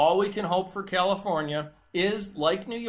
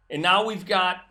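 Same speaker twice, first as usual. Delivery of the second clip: ordinary talk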